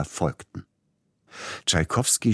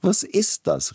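much higher startled exclamation; exclaiming; 3 dB louder, slower than usual. A narrator's reading. Tchaikovsky suddenly interrupting himself, startled cry. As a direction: cry out, catching yourself. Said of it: "rief Tschaikowski".

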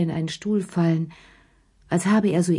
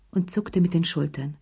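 ordinary delivery, nothing marked

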